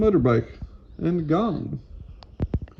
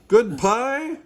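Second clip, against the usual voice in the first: high, loud rising question; hollered, high-pitched query; calling out; 10 semitones higher, 6 dB louder than usual. A narrator's reading. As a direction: call back in eager surprise. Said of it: "calls Bean".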